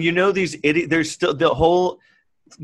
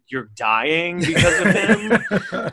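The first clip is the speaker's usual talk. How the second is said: mock disbelief; 2 semitones higher, much slower than usual. Real, not acted. Mocking dead Trump voter.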